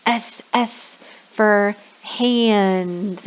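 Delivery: very slow, stretched S for hands; long sss in S for hands; drawn-out S. Shown as a demonstration. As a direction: hiss the S out long. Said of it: "Don't forget the S".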